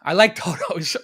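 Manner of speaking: put-on turtle kid. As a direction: imitate the kid from the viral clip. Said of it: "maybe the kid with the turtles".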